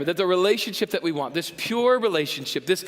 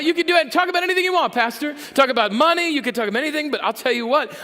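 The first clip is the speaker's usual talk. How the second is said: higher, louder, fast protest; mock indignant rant; 8 semitones higher, 4 dB louder, faster than usual. Pastor voicing an offended listener, fast mock indignant rant. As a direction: rattle it off, offended. Continues the outraged objector.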